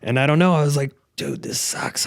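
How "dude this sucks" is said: low, soft whisper; whispered gripe. Retold or imitated. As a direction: breathe it out under your breath, fed up. Frustrated at broken vinyl cutter.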